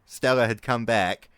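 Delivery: ordinary delivery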